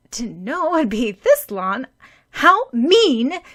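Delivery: loud, high pouting outrage; a hurt girl complaining about being left alone; indignant complaint. A narrator's reading. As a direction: sulk loudly at the injustice.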